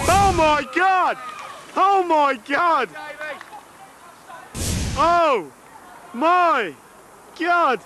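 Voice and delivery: slow, shocked oh my God; stunned disbelief. Real, not acted. Disbelief at a goal.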